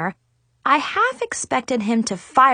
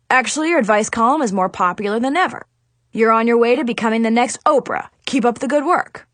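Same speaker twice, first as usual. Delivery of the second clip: fast, upbeat adviser voice; brisk praise; faster than usual. Acted. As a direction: rattle off the compliments briskly. Adviser suddenly praises the column.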